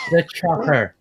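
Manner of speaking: put-on slow, drawn-out Cornish accent; Cornish Schwarzenegger. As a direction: stretch it in a mock Cornish burr. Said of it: "this kind of Cornish accent".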